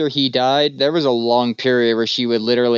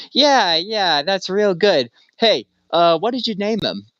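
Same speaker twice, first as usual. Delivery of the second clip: high, casual mock-friendly probing; sly visitor; 7 semitones higher than usual. Scheming visitor casually asking the emperor the dog's name, high and mock-casual. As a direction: act breezy while fishing for the name.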